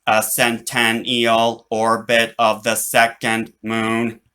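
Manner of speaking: slow, even machine delivery; robot voice. Acted. Robot answers the boy, slow and mechanical. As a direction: read it out like a computer.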